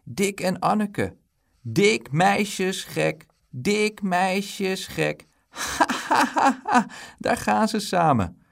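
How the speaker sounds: slow, high sing-song teasing with mocking laugh; jeering taunt. A narrator's reading. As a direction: chant it drawn out to mock them. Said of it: "Die moet altijd schelden".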